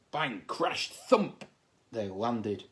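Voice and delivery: usual speaking voice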